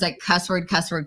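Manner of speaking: normal talking voice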